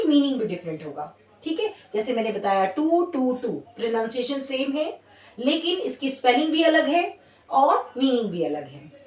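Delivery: ordinary talk, nothing marked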